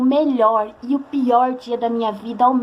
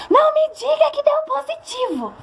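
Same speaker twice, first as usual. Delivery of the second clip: high, slow, shocked shriek imitating her mother; mother's shriek; 14 semitones higher, slower than usual. Mother on speakerphone hearing the test result, shocked high shriek. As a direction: shriek it like a horrified mom. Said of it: "Ela deu um berro".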